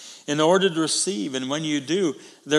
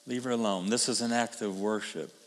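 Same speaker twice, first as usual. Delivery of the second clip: quietly reassuring, as Jesus defending the woman; gentle repeat; 6 semitones lower, 7 dB quieter than usual. Shown as a demonstration. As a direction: lower and softer, tender. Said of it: "Leave her alone. (repeated, softer)".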